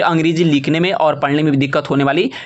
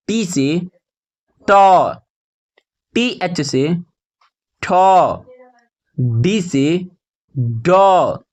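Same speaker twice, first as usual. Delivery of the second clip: slow chant of spelling, then the letter held long; measured classroom recitation with pauses; spelling drill; much slower than usual. Shown as a demonstration. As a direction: land firmly on each drawn-out consonant.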